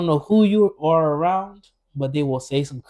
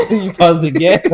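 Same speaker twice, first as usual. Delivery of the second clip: louder, laughing tease; teasing laugh; 4 semitones higher, 8 dB louder than usual. Real, not acted. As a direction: catch him out while laughing. Host teasing through laughter.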